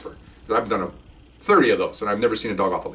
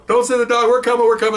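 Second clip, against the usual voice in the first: high, raised panicked call; louder pleading; 11 semitones higher, 4 dB louder than usual. Retold or imitated. Burglars surrendering to the police, loud and high. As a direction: shout that you're coming out. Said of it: "their voices are getting louder".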